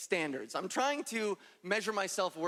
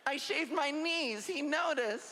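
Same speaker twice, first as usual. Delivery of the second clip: giddy inner voice; 6 semitones higher, slower than usual. Retold or imitated. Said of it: "I was like".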